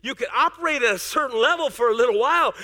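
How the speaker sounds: usual speaking voice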